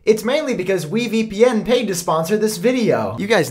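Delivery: echoing shower talk. Real, not acted. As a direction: speak up over the shower. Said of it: "why I'm in the shower right now".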